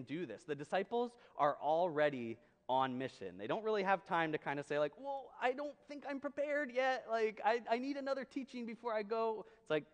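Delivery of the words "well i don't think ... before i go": high-pitched mock excuse-making; reluctant disciple. Disciple stalling before the mission, mock reluctant high voice. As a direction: pitch it well up, imitating a hesitant follower.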